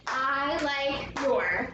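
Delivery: "I like your" slow, each word separate; one beat per word. Shown as a demonstration. Teacher counts one syllable each, slowly word by word. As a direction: space out "I like your" evenly. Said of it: "those are each one".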